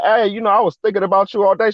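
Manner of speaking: lightly imitated lovestruck excitement; retold eager caller. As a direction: act out a smitten man on the phone, quickly. Retold smitten phone call.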